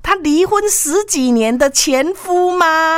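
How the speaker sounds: high, drawn-out disbelief; astonished. A narrator's reading. Storyteller reveals the intruder is the ex-husband, astonished. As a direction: play up the shocking reveal slowly and high.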